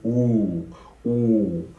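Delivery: "ooh" drawn out slowly with a dropped jaw. Shown as a demonstration. Teacher showing the jaw drop on a slow ooh. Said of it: "Notice that drop".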